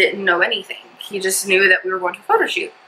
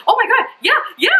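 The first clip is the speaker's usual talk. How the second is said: much higher, squealed mock excitement; excited realization; much faster than usual. Imitating mom as the news clicks, excited realization. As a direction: imitate mom suddenly getting it, pitched way up. Acts mom's delayed happy reaction.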